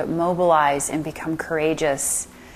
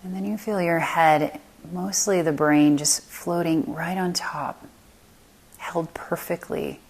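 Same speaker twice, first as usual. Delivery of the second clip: unhurried meditation voice, long silences between phrases; guided meditation. Acted. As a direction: lead them calmly through the body, letting phrases settle.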